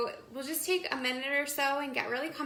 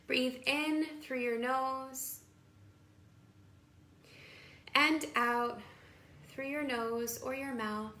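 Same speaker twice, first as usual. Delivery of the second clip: guided meditation. Acted. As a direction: guide them into calm, phrase by unhurried phrase.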